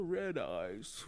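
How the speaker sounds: low, soft ad-man voice; commercial guy. Retold or imitated. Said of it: "guy from that Red Eyes commercial".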